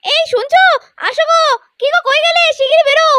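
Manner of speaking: high, urgent call across the house. Acted. Wife calling her husband to come quickly, high urgent calling. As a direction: call him out at once.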